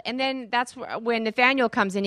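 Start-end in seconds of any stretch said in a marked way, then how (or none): none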